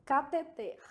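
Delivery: Cateter with stress on final TER; Cateter, last syllable stressed; marking the stressed syllable. Shown as a demonstration. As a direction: say ca-te-TER carefully. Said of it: "marcando as sílabas tônicas aqui".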